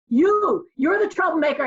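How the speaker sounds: imitated scolding shout; accusing principal. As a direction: pounce on the culprit, acting the principal. Principal catches and accuses her.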